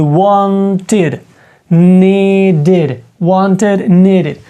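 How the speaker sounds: "wanted" and "needed" drawn out in two syllables; ending read as ID. Shown as a demonstration. Teacher modelling the rule for learners, "wanted", "needed" with id. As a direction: stretch "wanted" and "needed", clearly sounding the id. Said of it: "читаємо як «ід». У нас буде".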